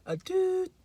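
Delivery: high, held electronic beep tone; imitated censor beep. Shown as a demonstration. Wanting to cover cursing, imitated high beep. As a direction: mimic a bleep on a high steady note. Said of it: "some kind of a beep".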